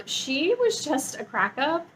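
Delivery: ordinary delivery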